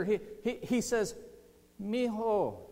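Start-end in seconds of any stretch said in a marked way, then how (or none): 1.8-2.6 higher-pitched fond call-out, mildly raised; affectionate call. Acted. Jesus calling to Peter from the shore, affectionate call. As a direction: call out fondly, not a full yell. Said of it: "he yells out to, to Peter".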